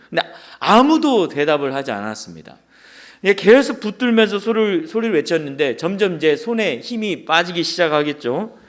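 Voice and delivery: ordinary talk, nothing marked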